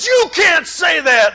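slow, high shouted objection; retold shout. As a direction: call it out like an angry crowd. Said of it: "they jumped up and said".

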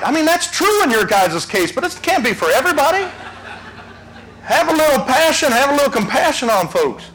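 high, ringing preacher's cry; fiery preaching. Acted. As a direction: preach it out, full force, to the congregation. Preaching at full force.

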